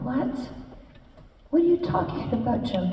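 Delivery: reliving the moment the news hit; shocked disbelief. Retold or imitated. Retelling hearing [name] died, a shocked high disbelieving cry. Told of [name]'s death on phone.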